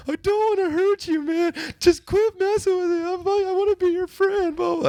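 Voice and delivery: put-on very high whiny crying kid; crying plea. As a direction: plead through tears like a scared boy.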